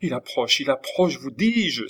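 acted panic with lifted pitch; terrified cry. A narrator's reading. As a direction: insist in fright. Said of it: "s'écria Lorenza, terrifiée".

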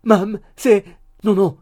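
loud, high urgent call; crying out. A narrator's reading. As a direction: cut her off with a loud cry. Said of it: "cria monsieur Tapertit". (cri fort et pressant)

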